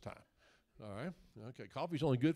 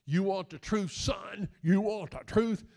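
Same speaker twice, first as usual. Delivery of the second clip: a loud, higher, gruff colonel's challenge; mock courtroom colonel, louder; Jack Nicholson impression; 4 semitones higher, 6 dB louder, slower than usual. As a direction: bark it like a Marine colonel on the stand. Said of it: "Jack Nicholson goes".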